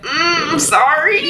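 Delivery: very high, meek little voice; sheepish mock apology. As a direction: imitate a squeaky, hesitant apology. Acted sheepish apology to superior.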